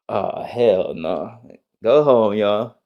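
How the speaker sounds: ordinary delivery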